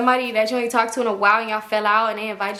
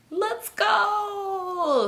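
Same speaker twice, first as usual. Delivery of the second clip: high, drawn-out whine, softer than the shout; crying kid; 10 semitones higher, 4 dB quieter than usual. Retold or imitated. Crying kid begging to leave, high drawn-out wail. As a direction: wail it long and high like an upset child. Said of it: "The kid's still crying".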